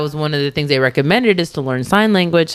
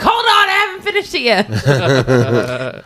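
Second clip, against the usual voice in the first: drawn-out, higher-pitched plea to wait; mock protest; 5 semitones higher, much slower than usual. Friend replaying her text to spoiling friends, mock protest. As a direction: stretch it out high, half laughing.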